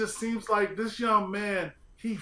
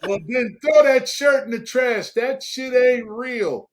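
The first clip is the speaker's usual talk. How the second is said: loud, higher-pitched dismissal; loud mock outrage; 5 semitones higher, 7 dB louder than usual. Real, not acted. Co-host rejects the host's shirt, loud mock outrage. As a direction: shout it down in teasing indignation.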